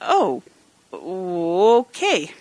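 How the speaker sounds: reluctant, drawn-out okay; hesitate, then agree without enthusiasm; unenthusiastic. Real, not acted. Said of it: "you don't sound very happy".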